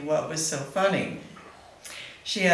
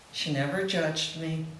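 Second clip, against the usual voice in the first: normal talking voice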